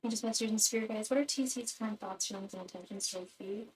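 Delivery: soft, repetitive invocation to spirits; prayer. Acted. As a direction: chant the question over and over. Repeated invocation to spirit guides.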